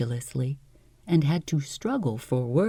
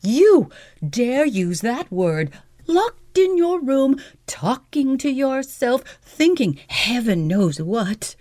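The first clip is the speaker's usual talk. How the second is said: indignant; 9 semitones higher than usual. A narrator's reading. Celia lashing back at him.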